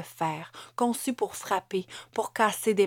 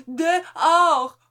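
much higher, loud "Dehors" with a long falling shout; shouted order; 10 semitones higher, 10 dB louder than usual. A narrator's reading. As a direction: bark him out of the house. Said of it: "ordonna Amélie". (ordre crié, aigu et fort)

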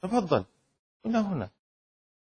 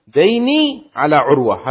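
ديني stretched long and loud, slowly; slow loud emphasis; 8 dB louder, slower than usual. Shown as a demonstration. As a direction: hammer the point home. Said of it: "واضح؟". (تأكيد بطيء وعالٍ)

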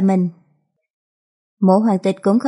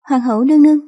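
raised, higher-pitched anxious call; 4 semitones higher, 4 dB louder, faster than usual. A narrator's reading. Worried concubine calling to the empress, raised anxious voice. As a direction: call after someone, worried.

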